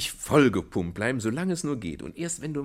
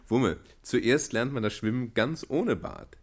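slow explanation; slower than usual. Acted. Father explains slowly.

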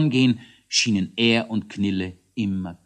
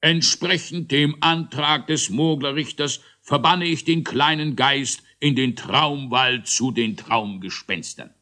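raised, sustained pronouncement; formal proclamation; 6 semitones higher than usual. A narrator's reading. Großmogler banishes the ghost at trial, proclaiming. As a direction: proclaim the sentence.

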